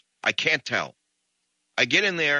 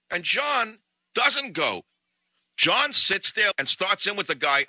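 usual speaking voice

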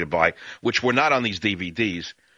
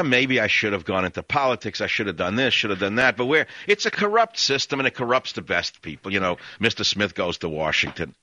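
normal talking voice

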